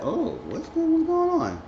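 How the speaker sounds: high-pitched mock alarm; retold upset outburst, voice jumping way up. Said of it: "Rondell was getting upset".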